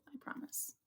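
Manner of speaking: whispered promise. Acted. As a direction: breathe it out as a whisper. Quiet aside after bravado.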